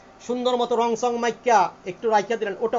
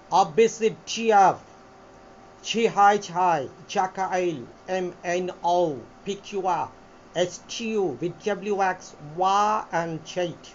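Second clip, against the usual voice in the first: alphabet run together in a lower British-American accent; 5 semitones lower than usual. Shown as a demonstration. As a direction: recite the letters in linked British-American style. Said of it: "British American accent এইভাবে করে".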